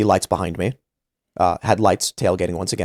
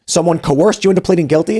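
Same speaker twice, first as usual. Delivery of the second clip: incredulous judge; 7 semitones higher than usual. Retold or imitated.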